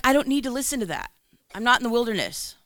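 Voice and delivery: high, loud, stubborn refusal. Retold or imitated. To God in the truck, a high stubborn refusal. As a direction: refuse flatly, a bit indignant.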